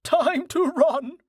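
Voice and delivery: very high, drawn-out horse voice; high-pitched animal character cry; eager horse. A narrator's reading. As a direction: announce it as a horse about to bolt, very high. Horse Dust decides to flee.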